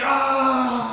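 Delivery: high loud ah; retold pained yell. Retelling his own yell when kicked, high shout. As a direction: cry out from a foot lock. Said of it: "I'm like".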